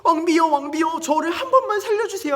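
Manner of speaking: very high, loud begging; desperate villain crying for mercy; frantic. Acted. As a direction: plead for your life in a hammed-up panic. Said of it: "지금 마음이 급한 거예요".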